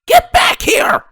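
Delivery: very high, loud yell; angry shout. A narrator's reading. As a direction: scream after her furiously. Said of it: "Rainbow shouted".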